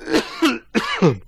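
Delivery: ordinary delivery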